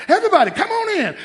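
high, loud, fast call. Retold or imitated. Dad calling the family in, high and loud. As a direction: call everybody in from the next room. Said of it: "And, right".

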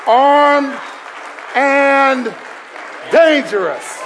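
loud, high shouted preaching; preaching at full force. Acted. Preacher shouting to the church, full force. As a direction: proclaim it to the congregation.

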